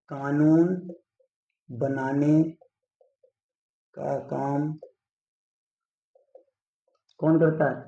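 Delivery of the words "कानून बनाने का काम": slow, word by word with long pauses; dictating while writing. Shown as a demonstration. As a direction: spell it out at board-writing speed. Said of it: "यहाँ पे हम लिखे दे रहे हैं". (लिखते हुए धीरे-धीरे, रुक-रुक कर)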